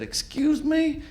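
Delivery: high, slow incredulous excuse me; drawn-out baffled question. Retold or imitated. Feigned confusion reply.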